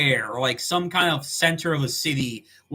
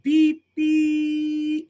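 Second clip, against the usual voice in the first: phone beep; 13 semitones higher, 3 dB louder than usual. Retold or imitated. Imitating the hang-up tone.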